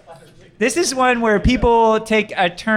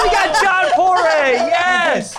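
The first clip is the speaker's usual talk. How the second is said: announcer voice; 9 semitones higher, much slower than usual. Acted. Announcing the phone-a-friend.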